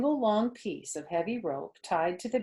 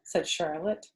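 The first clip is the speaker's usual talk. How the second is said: ordinary delivery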